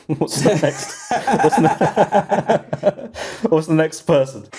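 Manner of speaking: helpless laughter through the words; cracking up. Real, not acted. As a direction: try to move on while laughing, pitch climbing. Cracking up at own failed joke.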